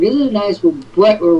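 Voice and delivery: ordinary talk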